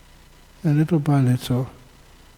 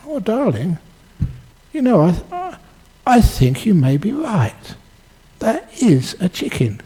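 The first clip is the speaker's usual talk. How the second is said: higher, gently giving in; sweetly conceding; 7 semitones higher than usual. Acted. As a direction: let him win, tenderly. Wife gives in sweetly.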